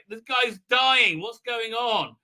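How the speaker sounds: high, drawn-out worried outcry; mock alarm. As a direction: imitate someone panicking over a patient. Acted alarmed woman.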